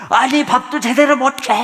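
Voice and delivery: hungry husband's mock outburst; angry snap at wife. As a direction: replay yelling at the wife. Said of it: "화를 냈어요".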